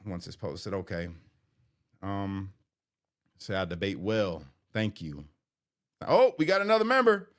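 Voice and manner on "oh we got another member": much higher and louder, delighted surprise; excited again. Real, not acted. Streamer to chat, thrilled that Tempest became a member. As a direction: announce it like a little win. Another member joins the live.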